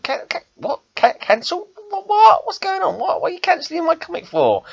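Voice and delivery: high, stammering outraged disbelief; climbing squeak of indignant shock. Retold or imitated. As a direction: sputter it out as if you can't believe the news. Retold shock at comic cancelled.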